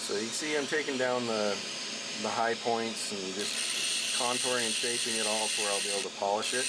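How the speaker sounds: talking up over the machine noise; over vacuum noise. Real, not acted. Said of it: "you'll have to excuse the vacuum noise".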